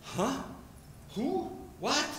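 short, high, bewildered questions; baffled crowd. Acted. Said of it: "the people are looking and going".

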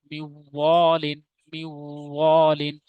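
"মিউ ওয়ালিন" with a long nasal w glide; slow chant, humming from "মিউ" into a drawn-out "ওয়ালিন"; ghunna recitation. Shown as a demonstration. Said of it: "গুণনা করে পড়া".

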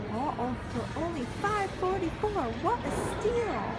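high, loud mock-infomercial excitement; hammed-up bargain delight. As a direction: stretch it out high like a gushing bargain hunter.

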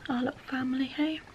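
tender whisper. Real, not acted. Tender moment after sigh.

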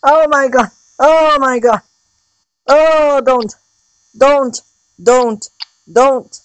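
high pleading cries, over and over; frightened high begging; panicked pleading. Real, not acted. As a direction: beg the monster to stop. Pleading as animatronic attacks.

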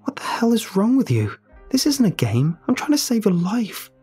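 angry outburst. Acted. Narrator yelling at Ellie to save her, furious. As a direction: lash out at her in rage. Narrator rises in sudden anger.

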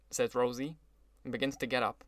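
ordinary delivery

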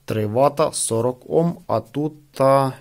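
usual speaking voice

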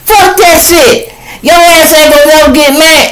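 high, loud furious woman; angry girlfriend. Acted. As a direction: screech at him for cheating. Woman yelling again.

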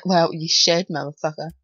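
ordinary talk, nothing marked

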